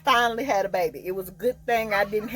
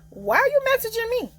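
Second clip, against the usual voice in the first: incredulous. Retold or imitated. Ex messages her out of nowhere.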